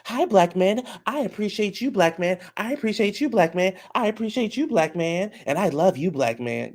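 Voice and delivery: high-pitched, sing-song gushing imitation; mock a gushing admirer in a high voice; Jasmine. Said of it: "here come Jasmine".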